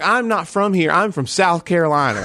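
high, exaggerated South Carolina twang; thick South Carolina accent. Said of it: "I lay it on thick".

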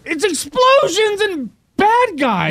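high, drawn-out hyped-up exclamation; mock action hype. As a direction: blow it up like an action blockbuster. Mock-hyped jab at action movies.

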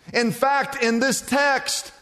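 ordinary talk, nothing marked

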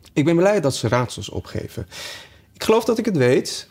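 put-on a bright young girl who thinks she knows; Alice voiced high. A narrator's reading. (hoger, gretig meisjesstemmetje)

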